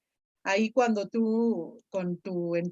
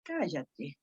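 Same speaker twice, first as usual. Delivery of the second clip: quiet cállate; 8 semitones lower, 9 dB quieter than usual. Shown as a demonstration. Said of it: "yo la leería así".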